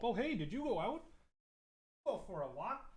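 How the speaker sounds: high, soft pet talk to a dog; soft high greeting to the dog back from a walk; cooing at the dog. Real, not acted. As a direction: coo gently at a returning dog. Greeting his dog softly.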